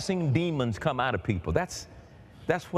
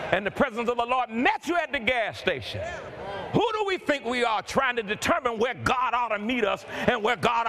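fervent preaching; 7 semitones higher than usual. Real, not acted. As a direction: preach it with mounting fire. Preaching at full force.

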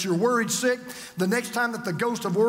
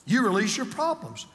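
ordinary delivery

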